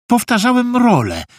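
high-pitched, overeager excuse; blurted excuse. A narrator's reading. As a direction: offer it as an improvised alibi. Boy blurts an improvised excuse. (wysoka, wymyślona naprędce wymówka)

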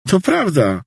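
high-pitched earnest protest; insistent janitor. Acted. (zapewnienie wysokim głosem)

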